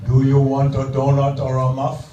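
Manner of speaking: loud, even-pitched mock Indian accent; Indian clerk. Donut-shop clerk asking a customer, mock Indian accent. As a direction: run it together in a thick Indian accent. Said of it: "I got a guy from India".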